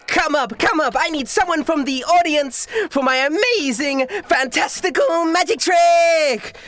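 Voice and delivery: very high, drawn-out showgirl hype; theatrical high-pitched announcer; stage magician showman. Acted. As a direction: call for a volunteer like a carnival barker. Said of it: "she goes".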